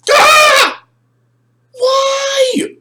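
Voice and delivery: very high, loud wail; hammed-up agonized shout; anguished scream. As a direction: cry out in mock despair. Acted reaction to toy breaking.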